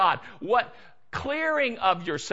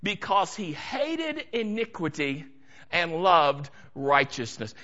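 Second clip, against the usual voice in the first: ordinary delivery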